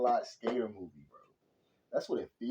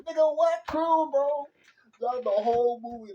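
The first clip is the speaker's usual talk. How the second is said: loud, high-pitched disbelieving yell; incredulous outburst; 9 dB louder than usual. Real, not acted. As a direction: explode at an obvious lie. Outburst at the character's lie.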